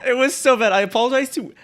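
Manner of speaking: high, loud, still giggling; laughing apology. Real, not acted. Host apologizing for a terrible accent, laughing. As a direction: apologize while fighting off laughter.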